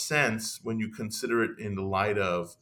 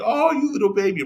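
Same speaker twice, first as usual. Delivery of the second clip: much higher and louder, quick affectionate coo; cooing baby talk; 12 semitones higher, 6 dB louder, faster than usual. Shown as a demonstration. Demonstrating how you coo at a baby, loving baby talk. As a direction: coo it like you're talking to an infant. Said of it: "tone of voice that you say".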